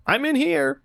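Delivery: high-pitched call from inside. Acted. Reader answering a knock on the stall, high-pitched call. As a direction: pipe up high to warn off a knocker.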